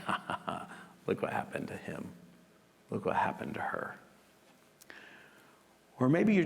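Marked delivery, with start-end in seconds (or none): 0.0-4.0 hushed, low mock laugh and sneaky gloat. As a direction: gloat under your breath, sliding into a whisper. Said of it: "You gloat".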